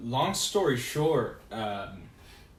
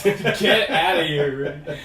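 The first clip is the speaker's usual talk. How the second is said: laughing protest, loud and slow; 5 semitones higher, 9 dB louder, slower than usual. Real, not acted. Laughing off friend's teasing.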